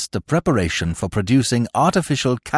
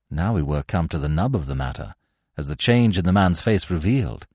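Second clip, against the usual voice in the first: ordinary delivery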